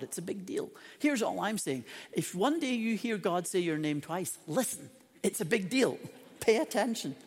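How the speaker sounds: ordinary talk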